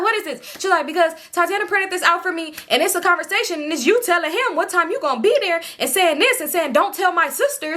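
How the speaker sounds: high-pitched, interrogating sister; exaggerated sister impression. Storyteller mimicking her sister confronting her with a printout, exaggerated and high. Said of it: "she ain't talking like that, though".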